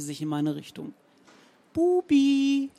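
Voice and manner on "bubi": much higher, louder coaxing call; Zenker impression. A narrator's reading. Romanow calls the poodle in Frau Zenker's voice, high. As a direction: imitate the old woman calling her dog. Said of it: "Romanows Glanzzeit als Stimmenimitator".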